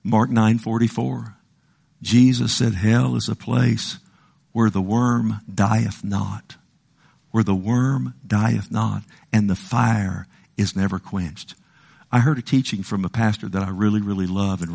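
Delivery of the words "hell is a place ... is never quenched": slow solemn reading. Acted. Slow solemn scripture quote.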